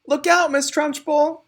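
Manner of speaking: high, loud warning cry. A narrator's reading. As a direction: shout a warning across the room. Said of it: "cried Lavender".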